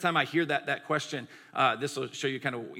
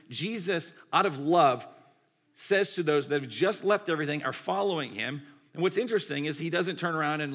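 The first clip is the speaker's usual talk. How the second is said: ordinary talk, nothing marked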